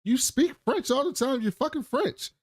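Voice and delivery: high-pitched incredulous quarrel voice; imitated exasperated squabbling; mock exasperation. Acting out the couple's argument.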